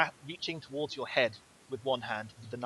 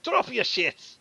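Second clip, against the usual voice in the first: much higher, loud order; bandits shouting; 9 semitones higher, 5 dB louder, slower than usual. Acted. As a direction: yell the order over drawn bows. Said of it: "they're like".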